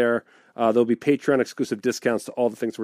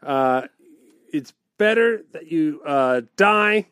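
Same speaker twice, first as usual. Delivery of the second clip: sing-song limerick recital; bouncy limerick lilt; 3 semitones higher than usual. Pretend. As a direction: bounce through the rhyme. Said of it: "would like a limerick".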